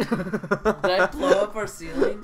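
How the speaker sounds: slow laughing question. Real, not acted. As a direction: laugh through the words. Laughing after explosion.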